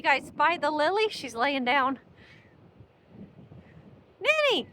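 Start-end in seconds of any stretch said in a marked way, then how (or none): 4.2-4.7 loud call across the dune. Real, not acted. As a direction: shout her name from afar. Calling out to the dog.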